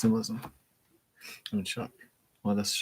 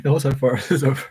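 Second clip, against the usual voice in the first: loud, helpless giggly talk; laughing; 2 semitones higher, 7 dB louder, faster than usual. Real, not acted. Reader loses it during the reading, laughing hard. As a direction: get the words out between bursts of laughter.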